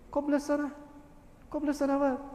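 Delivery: ordinary talk, nothing marked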